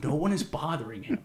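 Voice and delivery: ordinary delivery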